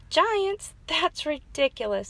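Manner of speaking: high, loud disbelieving giants; scoffing. A narrator's reading. Beaver mocking the giants story, scoffing. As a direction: scoff at the silly idea. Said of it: "said Beaver".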